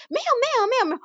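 put-on high, dismissive Taiwanese-accented elder; Taiwanese-accented Mandarin. Elder waving off her objection, in a Taiwanese accent. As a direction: brush it off like the uncle, in a Taiwanese accent. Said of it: "那个讲台语那个腔调".

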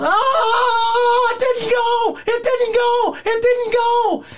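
very high, loud anguished shriek; scream of pain. Retold or imitated. Wrestler screaming when the shoulder won't go back in, high anguished shriek. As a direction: howl like the shoulder just failed to pop. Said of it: "all you hear is".

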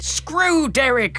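high, slow shout. Acted. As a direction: bellow it at him. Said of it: "Bernard shouts".

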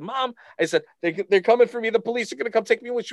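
put-on high-pitched, rushed child's plea to mom; tearful panicked kid. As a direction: imitate your frightened younger self. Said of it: "and I'm crying".

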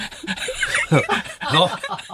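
high burst of helpless laughter; rolling with laughter. Real, not acted. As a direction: laugh hard, out of control. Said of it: "[name]さん笑い転げて".

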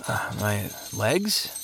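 slow, soft, dazed question; barely awake and puzzled; groggy confusion. Acted. Half-asleep man asked about his shackled legs, groggy.

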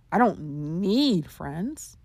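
"need" stretched out long, slow delivery; stress on NEED. Shown as a demonstration. Showing listeners another inflection, stress on "need". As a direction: draw out the word "need" with weight. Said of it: "how they emphasize certain words".

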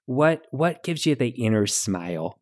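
Midwestern mom giving folksy advice; Midwestern mom accent. Acted. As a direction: answer like an understanding Midwestern mom. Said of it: "answer as a Midwestern mom".

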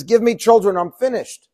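high, loud desperate outcry; a childless wife crying out to her husband. Acted. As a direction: cry out to him, you're finished. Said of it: "she cries out to Yaakov".

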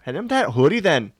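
folksy, put-on Wisconsin accent. Asking for a hoodie to be handed over, put-on Wisconsin accent. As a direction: keep up the hammy Wisconsin accent. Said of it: "all my Wisconsin accents".

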